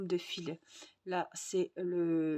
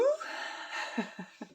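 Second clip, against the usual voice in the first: unvoiced breathy laugh; quick airy laugh; 4 semitones higher than usual. Real, not acted. Laughs after excitement.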